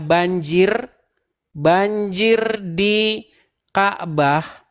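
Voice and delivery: slow, drawn-out search phrase; dictating for typing. Shown as a demonstration. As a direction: spell it out slowly, pausing between words. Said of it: "kalian ketik ya". (mendikte pelan-pelan)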